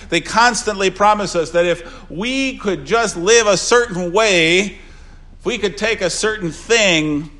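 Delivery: forceful pulpit delivery; preaching. Acted. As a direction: drive the point home to the mourners.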